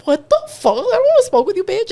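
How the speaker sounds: high, incredulous mock protest; retold outraged disbelief; indignant reaction. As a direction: act out an offended comeback, pitch climbing. Acted indignant reaction to catcallers.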